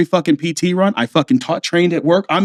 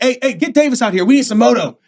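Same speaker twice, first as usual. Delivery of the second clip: higher-pitched imitated officer's call; officers calling out; 7 semitones higher than usual. Officers on a run want Davis, imitated call. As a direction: summon a motivator mid-run, pitched up.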